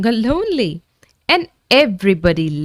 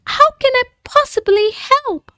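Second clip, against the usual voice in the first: very high, frightened squeak; a timid creature startled and scared; quaking with fear. A narrator's reading. Timid dragon answering Jake, quaking with fear. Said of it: "who was quaking with fear".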